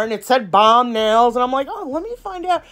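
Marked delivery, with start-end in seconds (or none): 1.7-2.6 curious thought. Retold or imitated. Quoting own curious thought.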